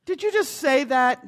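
startled burglar. Acted. Burglar startled by the talking parrot, alarmed. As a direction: blurt it out in fright.

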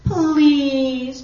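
long, loud, drawn-out plea; high wheedling please; begging. A narrator's reading. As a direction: beg with one stretched-out word. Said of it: "begged the bird".

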